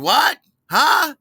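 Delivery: high, loud "What? Huh?"; straining to be heard in a noisy bar; shouting over noise. Retold or imitated. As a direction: yell like you can't hear a thing.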